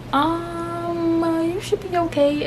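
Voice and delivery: high, held-out hesitant answer; doubtful hedging. Retold or imitated. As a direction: stall on a high note, then reassure weakly. Retold doctor hedging an answer.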